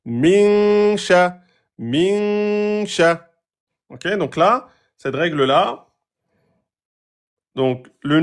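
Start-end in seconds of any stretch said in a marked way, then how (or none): 0.0-3.3 min sha with the n held two beats; two-beat noun. Shown as a demonstration. Teacher demonstrating two-beat noun to students. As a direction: hold the n of "min" for two counts before "sha". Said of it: "on fait bien un deux temps sur le noun".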